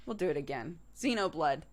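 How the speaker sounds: normal talking voice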